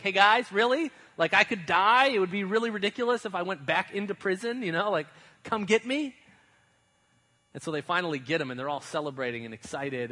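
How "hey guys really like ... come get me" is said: much louder and higher, fed up; exasperated shout. Retold or imitated. Peter locked outside calling to the believers, exasperated shout. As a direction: yell through the door to be let in.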